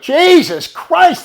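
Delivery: loud, high, drawn-out oath; slow shouted exasperation; exasperated cry. Retold or imitated. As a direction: stretch the curse out in disbelief. Exasperated reaction in retold exchange.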